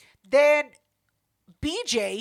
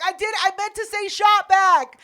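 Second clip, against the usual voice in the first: imitated pleading protest, loud and high; high, loud backpedalling imitation; desperate self-defence; 7 semitones higher, 6 dB louder than usual. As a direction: insist loudly, mocking his desperate excuse. Trying so hard to defend himself.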